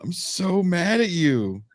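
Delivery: normal talking voice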